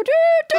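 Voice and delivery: high, loud toot-toot; imitated whistle. Host shows her co-host how whistleblowers blow, imitated whistle. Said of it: "they put a whistle in their mouth".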